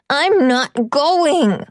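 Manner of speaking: put-on a little boy's stubborn yell; child yelling. Boy refusing to leave, yells it again. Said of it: "he yells again".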